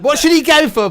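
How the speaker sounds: loud, high call to the crowd; rousing shout. Acted. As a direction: whip up the audience. Rousing the audience.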